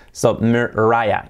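slow "Mariah" with "eye" in the middle; English long I. Shown as a demonstration. Teacher explaining the I sound, English pronunciation. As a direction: split "Mariah" as mer, RYE-a. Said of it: "en el formato I".

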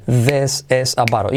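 "this is a bottle" slow and measured; slow repeat model. Shown as a demonstration. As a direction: repeat "this is a bottle" carefully for learners. ("this is a bottle" lento y claro, repitiendo)

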